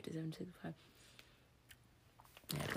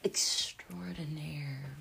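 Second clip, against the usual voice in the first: whispering. Real, not acted. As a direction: whisper it close to the mic.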